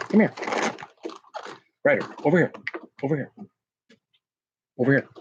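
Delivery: repeated high coaxing calls to a dog; calling the dog. Real, not acted. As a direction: keep calling him over, pitch up.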